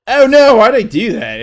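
high, loud cry of dismay, easing down; alarmed regret. Real, not acted. Player regretting a bad move, alarmed cry. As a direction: yelp it, then sink in pitch.

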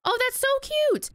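high, gushing delight; high cooing delight. Real, not acted. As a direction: coo over something adorable. Sees new locker in base.

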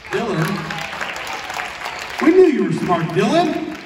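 ordinary talk, nothing marked

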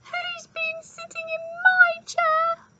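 very high, slow, drawn-out squeak; squeaky baby bear. A narrator's reading. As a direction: squeak it slowly like a tiny cub. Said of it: "squeaked Baby Bear".

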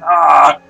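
loud yawning cry. Real, not acted. Loud cry after a yawn.

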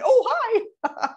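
high, loud laughing sing-song hi; bright high hello; cheery greeting. Shown as a demonstration. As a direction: chirp it with a laugh. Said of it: "but I am an".

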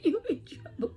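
high, loud, laughing taunt; mock ooh you in trouble; teasing singsong. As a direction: tease them in a singsong. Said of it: "They said".

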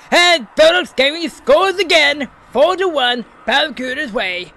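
high, loud excited goal call; sports commentator. Acted. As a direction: shout the score like a commentator. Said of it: "my commentating voice is very on point".

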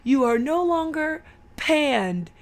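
dad's hammed-up proclamation; imitated dad proclaiming. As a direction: announce it grandly, drawn out. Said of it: "He's like".

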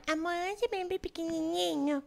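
lightly mocking cutesy baby voice to a boyfriend; baby talk. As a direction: coo it like talking to a baby. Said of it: "faz vozinha de nenê".

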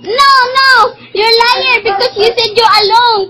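ordinary delivery

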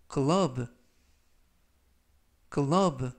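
teacher spelling out 글러브 for learners; model pronunciation. Shown as a demonstration. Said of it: "글러브라고 발음하시면 되겠습니다".

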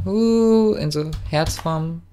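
usual speaking voice

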